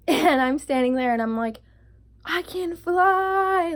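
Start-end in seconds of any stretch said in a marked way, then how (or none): 2.3-3.7 high, loud, drawn-out cry; hammed-up euphoric exclamation; mock elation. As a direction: stretch it out like launching into the air. Imitating herself high, about to jump.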